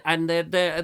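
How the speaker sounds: ordinary delivery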